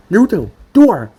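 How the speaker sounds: loud call across the room; sharp call to come in properly; shout. A narrator's reading. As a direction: yell his name to the door.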